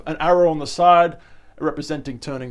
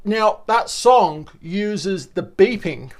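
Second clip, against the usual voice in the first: ordinary delivery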